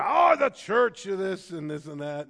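loud retold grumble about church. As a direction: scoff at the church like he did. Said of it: "he complained".

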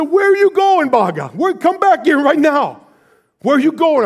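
high, loud demanding cry; bawling child's yell. Retold or imitated. Boy crying and shouting at his departing grandpa. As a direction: shout it through tears like an angry kid. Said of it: "I'm just bawling".

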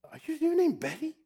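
high-pitched mock disbelief; incredulous question. Retold star questions boy's name.

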